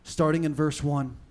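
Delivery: usual speaking voice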